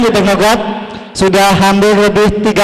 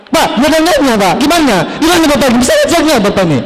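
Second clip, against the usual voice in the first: mock angry student; 5 semitones higher than usual. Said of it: "Melihat saya".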